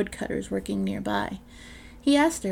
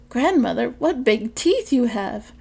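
put-on slow, louder, amazed child; the girl gasps at the wolf in granny's bed, slow and louder; wide-eyed wonder; 4 semitones higher, 7 dB louder than usual. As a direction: marvel at each strange feature.

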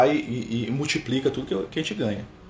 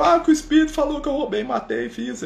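whiny retelling; 13 semitones higher than usual. Retold or imitated.

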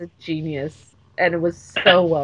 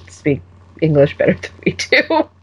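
ordinary delivery

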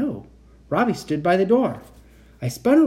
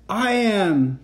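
mild, higher-pitched shout; shouted claim; 6 semitones higher than usual. A narrator's reading. Robot Victor answering Kat closest to her, shouted claim. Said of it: "the one closest to me shouted".

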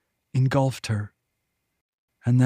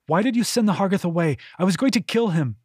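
fast, high angry demand; 6 semitones higher, faster than usual. A narrator's reading. She rounded on the Demain.

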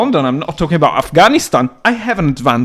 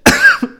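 sudden high burst of laughter; 6 dB louder than usual. Real, not acted. Recalling an absurd job offer, with a sudden high laugh. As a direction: break out laughing at the memory.

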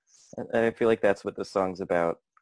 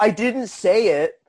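mock protest; 11 semitones higher, 5 dB louder, slower than usual. Protesting innocence, joking.

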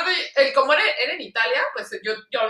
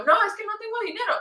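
high-pitched excuse; retold refusal; 9 semitones higher, 3 dB quieter than usual. Tourist begs off buying a rose, high mock excuse. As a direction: imitate yourself begging off, pitched up. Acts her refusal to the seller.

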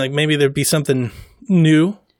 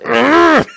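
disgusted groan; 10 semitones higher, 3 dB louder than usual. Retold or imitated. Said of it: "the collective internet just be like".

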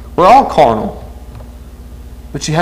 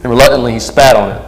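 dramatic story reading. Acted. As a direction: read the martyr story with gravity. Reads martyr story over music.

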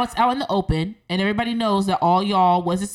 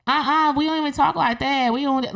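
higher-pitched imitation of Alabama cousins; Alabama accent; 6 semitones higher than usual. Quoting cousins from Alabama objecting, Alabama accent. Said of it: "my cousins from Alabama".

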